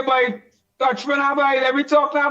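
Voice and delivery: usual speaking voice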